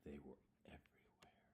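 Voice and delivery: whispered, barely voiced storytelling; soft whispered reading; hushed whisper. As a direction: drop to a secret whisper.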